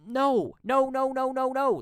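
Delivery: loud, high rapid-fire no's; outraged refusal. Real, not acted. Rejecting the media's story, loud high outrage. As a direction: shout it down in a high, insistent volley.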